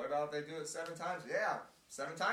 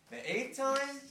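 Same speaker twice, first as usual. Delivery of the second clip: surprised; 13 semitones higher, 8 dB louder, slower than usual. Real, not acted. Incredulous echo of eighth time.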